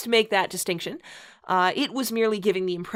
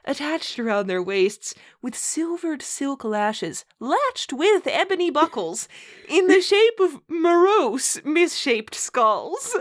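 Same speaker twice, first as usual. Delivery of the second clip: mock-grand read-aloud, stifling laughter; story narration; 7 semitones higher, slower than usual. Host reading a bad fanfic to co-host, mock-solemn narration. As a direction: read the purple prose with a straight face.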